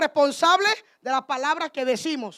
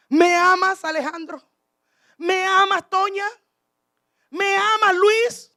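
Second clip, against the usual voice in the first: raised, higher voice with a pause between questions; calling questioning; 5 semitones higher, 5 dB louder than usual. Acted. Christ asking members of the congregation one by one, calling questioning. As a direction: put the question loudly to each person by name.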